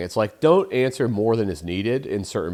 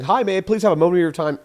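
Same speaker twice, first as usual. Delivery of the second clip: higher, louder, quick pleading opener; mock polite caller; 7 semitones higher, 5 dB louder, faster than usual. Shown as a demonstration. Salesman asking a stranger for a moment, mock polite.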